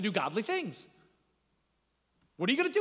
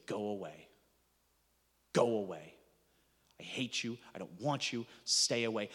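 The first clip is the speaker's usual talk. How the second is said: bitter rejection; 9 semitones lower, 4 dB quieter than usual. Acted. Voicing the damned rejecting God.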